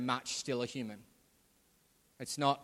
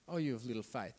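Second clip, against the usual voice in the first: quieter, tutting talk-down repeat; softer mock condescension; 4 semitones lower, 7 dB quieter than usual. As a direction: drop the voice as you talk down to him. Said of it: "It's like he's been condescending".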